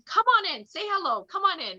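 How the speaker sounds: a hint of a cheerful boss waving her in; imitating welcoming principal. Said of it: "Today he goes to me again".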